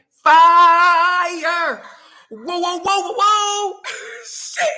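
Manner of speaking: high, loud gasping at the heat; burning mouth. Retold or imitated. Telling viewers about the spicy dip, gasping at the heat. Retelling eating spicy beans.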